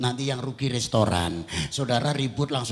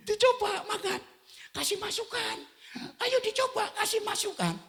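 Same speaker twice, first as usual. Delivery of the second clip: high-pitched imitation of the wife, pushing; hammed-up nagging wife asking for feedback; wife pressing him to taste the dish. Wife voiced in sermon story.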